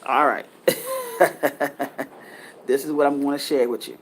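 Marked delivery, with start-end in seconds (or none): 0.7-2.0 a short amused chuckle; brief sheepish laugh; light laugh. Real, not acted. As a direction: laugh at being told to chill. Laughs after being told chill.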